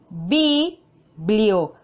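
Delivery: biblioteca slowly split, stress on te; stress on TE, syllable by syllable. Shown as a demonstration. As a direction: say biblioteca as bi, blio, TE, ca with pauses. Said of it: "Realizamos la mayor fuerza de voz". (biblioteca por sílabas, con fuerza en te)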